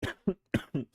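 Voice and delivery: harsh coughing fit; real choking cough. Real, not acted. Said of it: "Sorry, I'm choking".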